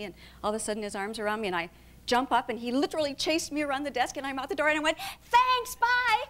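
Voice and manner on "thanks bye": high, loud mock-bright farewell; panicked cheery exit. Actress fleeing a lecherous audition, high hurried call.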